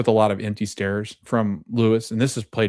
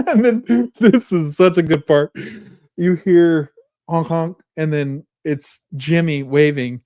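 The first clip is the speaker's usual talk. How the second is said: high, loud telling through laughter; real amused laughter; 6 semitones higher, 6 dB louder than usual. Real, not acted. Podcaster recaps a favourite funny scene, laughing. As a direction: laugh while setting up the funny bit.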